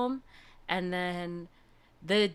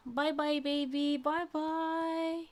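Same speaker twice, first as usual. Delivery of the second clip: cooing goodbye; 10 semitones higher, 3 dB quieter, much slower than usual. Real, not acted. Saying goodbye to the kitten on screen, higher soft coo.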